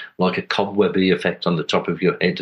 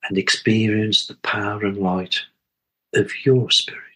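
calm, spacious meditation leading; guided meditation. Acted. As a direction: guide listeners gently inward, phrase by unhurried phrase.

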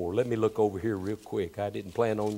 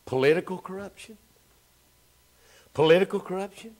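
high, louder, ringing refrain; higher, louder preacher's refrain; preaching at full force; 10 semitones higher, 5 dB louder than usual. Acted. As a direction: hammer the refrain home. Repeated sermon refrain.

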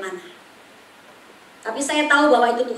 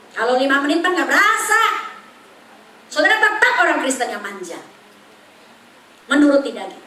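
ordinary talk

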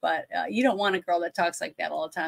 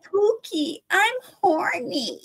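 mock a breathy starlet line; imitating Leslie Ann Warren's irritating voice, much higher; irritating sexy voice; 10 semitones higher, slower than usual. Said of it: "that irritating voice".

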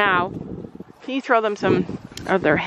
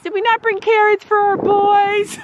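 very high, louder pet voice; 11 semitones higher, 4 dB louder than usual. Real, not acted. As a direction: squeal it at the horses. Baby-talking to the horses.

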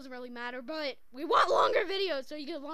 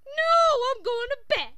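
put-on loud, high child's refusal; defiant tantrum; 9 semitones higher, 12 dB louder than usual. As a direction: yell back at your parent like a sulky kid. Child defies parent in tantrum.

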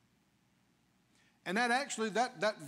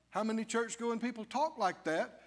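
ordinary delivery

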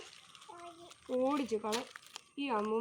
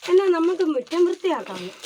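much louder, higher eager crow call; rally the other crows to clean; 4 semitones higher, 12 dB louder than usual. Acted. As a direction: crow shouting to the flock. Crows decide to clean yard.

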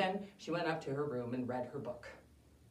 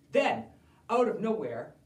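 ordinary delivery, nothing marked

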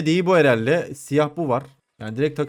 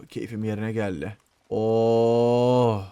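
normal talking voice

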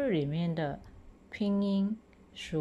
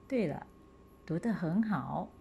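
ordinary talk, nothing marked

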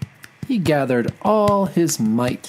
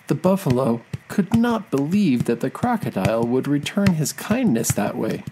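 ordinary delivery

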